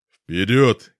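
loud battle cry; shout. A narrator's reading. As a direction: yell the command forward. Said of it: "крикнул я".

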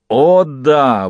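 put-on loud exclamation in a Dock accent. A narrator's reading. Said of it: "выговорил паяц с сильным акцентом Дока".

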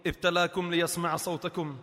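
tender quiet preaching; gentle consolation. Acted. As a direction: soothe the afflicted softly.

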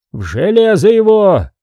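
high-pitched, forceful command; shouted order. A narrator's reading. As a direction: cry out an order to seize him. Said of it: "крикнул Пимен".

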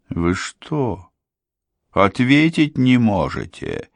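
хриплый, нетерпеливый окрик незнакомца; сипло, с раздражением; сиплый голос. A narrator's reading. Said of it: "сиплым голосом повторил". (сиплый голос незнакомца)

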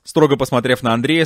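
ordinary delivery

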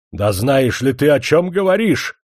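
высокий громкий крик; взорвись гневным криком. Acted. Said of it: "закричал князь". (князь в гневе кричит на Кашкина)

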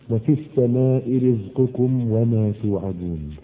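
low, slow intoned verse; slow Quranic recitation. Acted. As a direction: chant the verse softly and slowly.

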